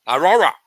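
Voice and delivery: "Aurora" blurted loud and high, r sounds run together; speech-impediment struggle. Shown as a demonstration. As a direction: blurt "Aurora" forcefully, wrestling with the r's. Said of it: "fucking with my speech impediment".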